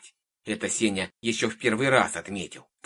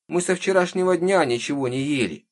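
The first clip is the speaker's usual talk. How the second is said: high-pitched, loud pleading; plaintive child; 9 semitones higher, 5 dB louder than usual. A narrator's reading. Hungry girl complaining to the witch, plaintive. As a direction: complain in a little girl's whine. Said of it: "жалобно протянула девочка".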